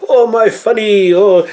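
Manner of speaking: high, loud mock German professor accent; a hammed-up old German professor accent exclaiming; parodying Mel Brooks's German professor accent. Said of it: "German professor accent".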